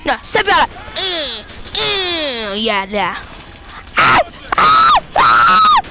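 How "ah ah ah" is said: very high, loud shriek; panicked scream. Real, not acted. Panicked scream as car is stolen.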